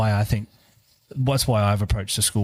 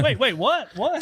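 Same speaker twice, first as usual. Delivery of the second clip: high, laughing surprise; surprised, laughing; 13 semitones higher, slower than usual. Retold or imitated. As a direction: caught off guard, laughing. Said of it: "You go".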